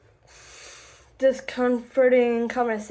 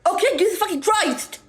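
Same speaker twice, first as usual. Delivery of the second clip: imitating his angry outburst, much higher; exasperated yell; 8 semitones higher, 3 dB louder, faster than usual. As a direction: throw his irritation right back at her. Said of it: "he would go".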